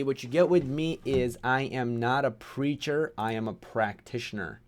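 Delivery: usual speaking voice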